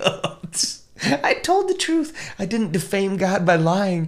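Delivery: high, slow giggly voice; mock fit of laughter. Sister after the Gestapo leave, acted laughing fit. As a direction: act out a girl giggling with relief. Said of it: "the girl gets fits of laughter".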